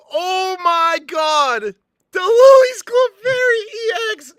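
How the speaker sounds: high, loud screams of disbelief; ecstatic screaming. Real, not acted. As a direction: shout in wild joy at the rare pull. Still screaming over the rare pull.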